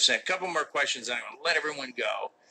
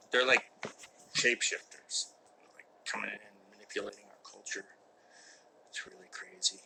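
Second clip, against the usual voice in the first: whispered mock warning about infiltrators; conspiratorial whisper; 3 semitones lower, 4 dB quieter than usual. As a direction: tell it like a secret only you know. Conspiracy joke about Canadians.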